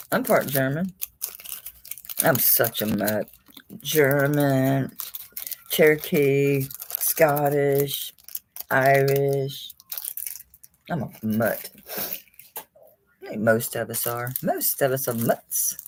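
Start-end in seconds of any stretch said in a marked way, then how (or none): none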